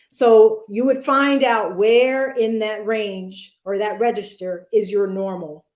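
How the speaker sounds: ordinary delivery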